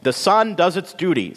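high, firm big-sister correction; indignant retort. A narrator's reading. Bean Plant rejects Lily's sad news, indignant.

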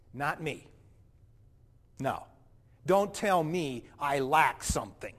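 put-on indignant, self-righteous young man telling his story; a hurt, defensive rich young man recalls meeting Jesus. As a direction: play the proud rich man, wounded and defensive. First-person monologue as the rich young man.

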